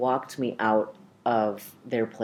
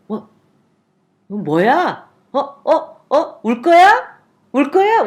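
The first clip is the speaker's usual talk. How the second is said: high, loud teasing in Korean; comic quizzical dad; 13 semitones higher, 10 dB louder, faster than usual. Retold or imitated. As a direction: imitate a dad mocking someone about to cry. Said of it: "with this quizzical, comic, like, comedic look".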